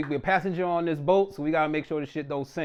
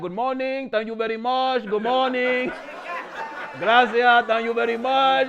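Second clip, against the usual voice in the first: cheery loud, raised imitation of a Spanish speaker; Maduro; 4 semitones higher, 9 dB louder, slower than usual. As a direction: greet everyone in a hammed-up Spanish accent. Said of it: "he was like".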